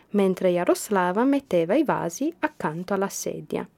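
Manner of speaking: ordinary delivery, nothing marked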